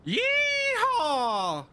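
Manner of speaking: high, loud cowboy whoop; cowboy yeehaw. Pretend. Streamer performing for chat, loud high cowboy yeehaw. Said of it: "let me give you a little yeehaw".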